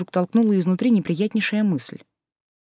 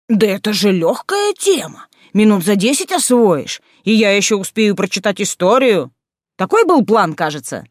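louder, higher exasperated outburst; flared-up outburst; 4 semitones higher, 6 dB louder than usual. Acted. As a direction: flare up in irritation. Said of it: "Люций вспыхнул".

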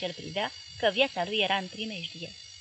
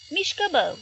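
barked order, loud and higher; rough shout; 7 semitones higher, 6 dB louder than usual. Acted. Soldier shoving the doctor with his rifle, rough shout. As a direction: shove him aside with your voice.

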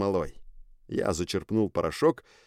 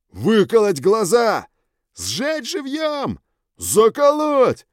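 very high, slow shrieks; shrieking gang; 13 semitones higher, 7 dB louder, slower than usual. Acted. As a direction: scream each cruel idea. Gang shouts how to kill him.

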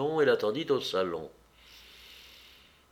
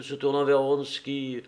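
usual speaking voice

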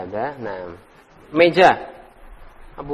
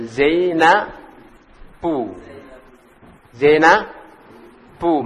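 very slow; much slower than usual. Shown as a demonstration. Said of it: "Wasmul binti".

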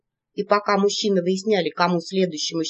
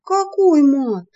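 high, loud, slow outrage; offended protest; indignant; 7 semitones higher, 6 dB louder, much slower than usual. A narrator's reading. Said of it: "возмутилась я".

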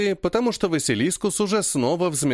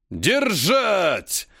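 roaring; 6 semitones higher than usual. A narrator's reading. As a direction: roar the command at them. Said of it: "ревёт им Дубный".

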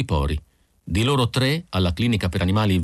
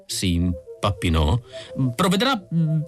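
higher, flustered clinic driver; nervous Isidor; 7 semitones higher than usual. A narrator's reading. Isidor breaking the news to Mrs Pilsen, higher and flustered. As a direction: blurt it out, a bit panicky.

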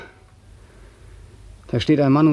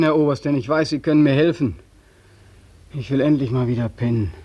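usual speaking voice

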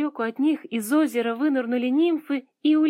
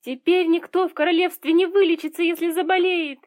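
high, raised cry of distress; 7 semitones higher, 4 dB louder than usual. A narrator's reading. Girl fearing nobody can be cured, crying out. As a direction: exclaim in alarm, pitched high. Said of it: "вскрикнула Элли".

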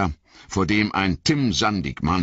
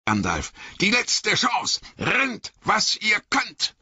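much higher, urgent cry to run; a companion warns Gandalf to flee, urgent high call; urgent warning. A narrator's reading.

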